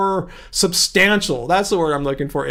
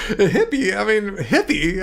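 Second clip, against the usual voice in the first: mock California hippie. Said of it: "I sound like a California hippie".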